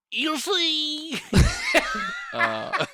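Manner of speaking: high, loud Southern comic delivery with laughs; Georgia comedian voice. Acted. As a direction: play a Georgia standup comic. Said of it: "here's my Georgia comedian voice".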